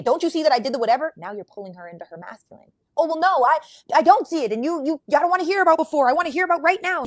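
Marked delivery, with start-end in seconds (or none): 0.0-1.1 loud, fast, higher complaint; exasperated man. Retold or imitated. Voicing upset man again. 2.9-7.0 much higher, loud heated protest; upset woman. Retold or imitated.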